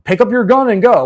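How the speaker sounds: hammed-up order to take up arms; mock rallying cry. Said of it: "she was saying".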